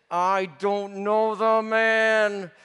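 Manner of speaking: slow, hissed through clenched teeth. Acted. Peter to the servant by the fire, slow clenched-teeth hiss. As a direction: bite back the shout and hiss it. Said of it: "I just hissed".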